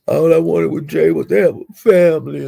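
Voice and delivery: imitated whiny complaint; lightly mocking whiner's voice; mock whining. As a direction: mimic him whining about what he wanted. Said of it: "He was whining and bapping like a hoe".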